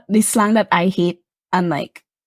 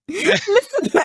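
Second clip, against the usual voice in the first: laughing teacher impression; 10 semitones higher, slower than usual.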